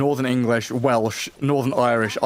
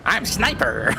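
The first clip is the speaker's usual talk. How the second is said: put-on American accent; 6 semitones higher, slower than usual. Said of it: "It's just classic American accent".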